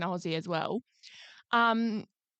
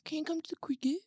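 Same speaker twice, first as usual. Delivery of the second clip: soft pleading plea; puppy dog eyes plea; 3 semitones higher, 9 dB quieter than usual. Retold or imitated. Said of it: "gave me the puppy dog eyes".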